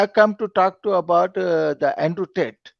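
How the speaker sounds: usual speaking voice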